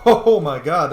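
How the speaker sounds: sudden loud "oh my god" at the screen; real surprise at a heavy hit; startled exclamation. Real, not acted. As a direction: blurt it out, caught off guard. Startled by a big hit in game.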